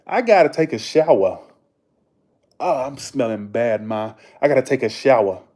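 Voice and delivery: loud Massachusetts accent, dropped r's. Shown as a demonstration. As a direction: holler to Ma in a broad Massachusetts accent.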